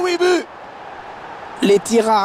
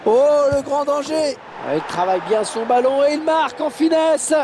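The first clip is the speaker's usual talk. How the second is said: goal call; 11 semitones higher than usual. Real, not acted. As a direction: lift the voice as he scores. Goal chance then goal.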